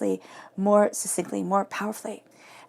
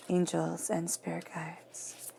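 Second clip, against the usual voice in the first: slow, hushed whispered invocation; 2 semitones lower, 9 dB quieter, much slower than usual. Acted. Reader calling on angels before the cards, slow whisper. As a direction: breathe the words like a secret prayer.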